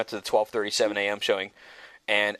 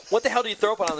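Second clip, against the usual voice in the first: frantic disbelief; 9 semitones higher, faster than usual. Retold or imitated. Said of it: "And I'm going crazy".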